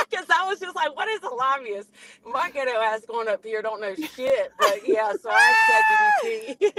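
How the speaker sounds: high squealing laugh through words. Real, not acted. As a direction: laugh while telling it. Laughing at own story.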